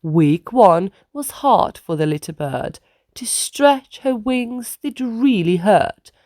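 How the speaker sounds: usual speaking voice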